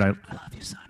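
a tearful father hushing it out; choked-up dad. Retold or imitated. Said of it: "He broke down crying on the phone".

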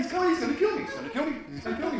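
high frantic repetition, fading away; retold panic. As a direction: start high and panicky, sink with each repeat.

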